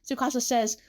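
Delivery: plain reading voice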